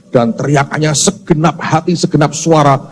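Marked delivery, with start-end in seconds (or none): none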